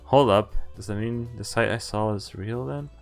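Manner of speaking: normal talking voice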